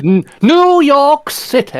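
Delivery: high, loud, drawn-out outlandish pronunciation; hammed-up slow, mannered enunciation; Matt Berry. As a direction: stretch each word into absurd grandeur. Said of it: "pronouncing things in a really, really outlandish way".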